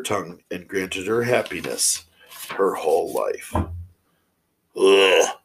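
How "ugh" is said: loud groan of distaste; short, loud grunt of displeasure; disgusted ugh. Real, not acted. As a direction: let out a real "ugh" of dislike. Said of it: "I didn't like that one".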